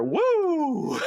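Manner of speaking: a high, hollered wrestler's Woo!; Ric Flair woo. Retold or imitated. Host imitating the wrestler's signature cry with a high woo. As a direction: belt it out high like a wrestling promo. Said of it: "giving his woos".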